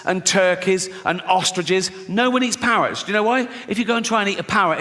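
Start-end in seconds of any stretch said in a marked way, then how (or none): none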